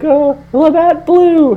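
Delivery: high, slow mock call; mock mom yell. Buddy's mom naming the beer to the guest, high mock yell. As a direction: answer downstairs in a high imitation mom voice. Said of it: "she yells down".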